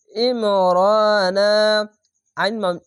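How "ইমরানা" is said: ইমরানা chanted slowly from a squeezed throat, "ra" held; slow recitation of ইমরানা with heavy opening; thick throat ain. Shown as a demonstration. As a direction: squeeze the first vowel of ইমরানা, draw out the middle. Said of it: "মোটা করে গলা চিপে পড়বেন".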